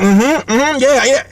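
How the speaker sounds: agreeing along. Retold or imitated. Hungry guests answering the host's beliefs, mock agreeing. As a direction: mimic guests yessing the host. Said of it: "are these guys agreeing with me".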